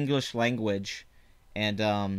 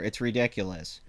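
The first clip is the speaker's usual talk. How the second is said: ordinary talk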